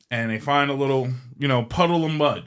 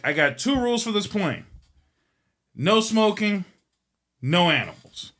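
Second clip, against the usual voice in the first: mock-firm pilot, raised pitch at first; laying down rules; 6 semitones higher than usual. Pilot telling passengers his plane's rules, mock-firm. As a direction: spell out each rule with pauses between.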